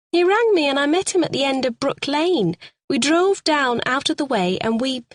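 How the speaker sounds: a frightened suspect begging to be believed. A narrator's reading. Suspect pleads her story to the sergeant, high and pleading. Narrator voicing [name].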